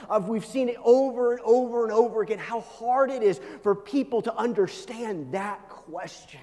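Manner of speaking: high, loud, driving sermon delivery; impassioned preaching. Real, not acted. Preacher confronts congregation with the world's suffering, impassioned preaching. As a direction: press the question on the congregation at full force.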